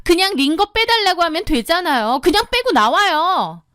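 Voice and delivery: high, pushy demand; bratty sister-in-law. Acted. Sister-in-law pushily telling the patient to leave. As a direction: tell her to just pull the IV.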